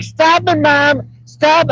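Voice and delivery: high-pitched whiny imitation of her; mock giggly daughter. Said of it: "she's like".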